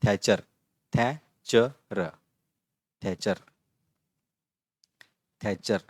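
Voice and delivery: थैचर repeated, the second very slow; teacher models थैचर; careful pronunciation. Shown as a demonstration. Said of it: "इसमें से लेंगे हम".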